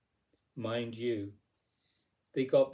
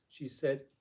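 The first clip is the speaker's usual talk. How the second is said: ordinary delivery, nothing marked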